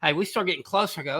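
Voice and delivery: ordinary delivery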